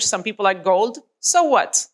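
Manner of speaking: ordinary talk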